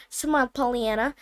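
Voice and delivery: ordinary delivery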